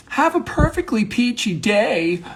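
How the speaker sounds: high, chirpy mock send-off; perky farewell. Monologist trying out a fun way to say goodbye, perky.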